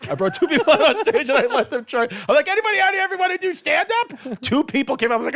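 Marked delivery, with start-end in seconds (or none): 2.5-4.4 calling out. Retold or imitated. Comic calling out to crowd.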